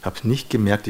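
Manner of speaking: usual speaking voice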